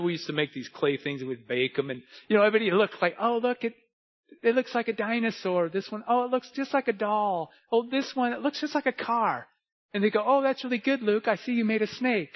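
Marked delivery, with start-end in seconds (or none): 3.2-9.5 put-on higher-pitched cooing over children's crafts; put-on high voice, impressed by clay models; admiring onlooker. 10.3-12.3 lightly acted, higher-pitched compliment to a child; a touch of put-on encouragement; polite praise. Acts out reaction to his clay snake.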